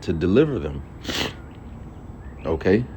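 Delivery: normal talking voice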